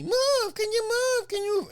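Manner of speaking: very high-pitched mock nagging woman; nasty, ugly voice. Lady behind a fighter nagging him, nasty high voice. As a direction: screech it like an irritating heckler. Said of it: "ugly, ugly voice".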